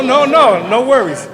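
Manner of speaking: high, loud, quick no no no; loud laughing denial. Real, not acted. Brushing off a colleague's joke with a loud laughing denial. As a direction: wave off the joke loudly.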